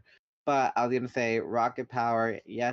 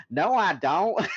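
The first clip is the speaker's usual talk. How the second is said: higher, louder kid in a mock British accent; little British accent; 8 semitones higher, 7 dB louder, slower than usual. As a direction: insist in a child's British accent. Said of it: "goes back to his little British".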